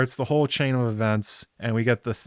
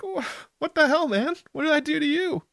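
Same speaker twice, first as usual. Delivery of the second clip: much higher, squeaky indignant whine; hammed-up betrayed little brother, pitched way up; outraged Aegon. Aegon, sold out by his brother, squeaks an indignant protest. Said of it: "And Aegon's like".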